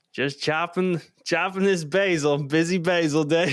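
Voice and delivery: mock the clueless cook in a thick Southern accent. Cook imitating a clueless new guy, thick Southern accent. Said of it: "Like, a really thick Southern accent".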